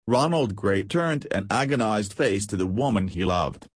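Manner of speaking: usual speaking voice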